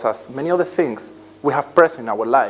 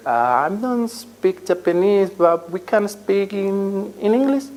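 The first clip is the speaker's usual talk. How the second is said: halting, drawn-out, higher-pitched reply; hesitant interviewee; 7 semitones higher, slower than usual. Acted. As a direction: stall awkwardly, stretching words as you search for them. Acts out a hesitant interview answer.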